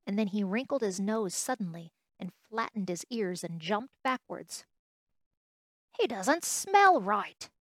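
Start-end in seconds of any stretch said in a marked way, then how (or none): 6.0-7.5 loud, slow, high exclamation; exclaiming. Acted. Wild rabbit jumps back from the toy, exclaiming. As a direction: exclaim it, drawn out. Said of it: "he exclaimed".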